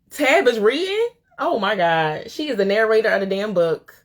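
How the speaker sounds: high-pitched amazed question, then marveling; thrilled disbelief. Real, not acted. Host hearing the author narrates, thrilled disbelief. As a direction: react to wonderful news you can hardly believe.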